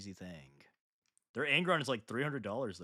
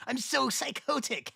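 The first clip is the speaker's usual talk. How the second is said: manic; 12 semitones higher, 4 dB louder, slower than usual. Pretend. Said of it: "You're a sick man".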